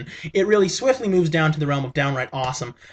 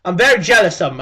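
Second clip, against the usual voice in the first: mock British accent, higher and louder; hammed-up British accent calling him a git; 5 semitones higher, 5 dB louder than usual. Vlogger mocking his friend in a British accent. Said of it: "I'm using this accent".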